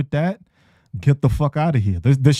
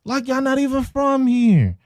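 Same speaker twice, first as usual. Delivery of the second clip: much higher and set apart from the first take; squeaky mock-incredulous repeat; high-pitched repeat; 11 semitones higher than usual. Shown as a demonstration. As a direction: replay it up high, mock disbelief. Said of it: "repeats the line".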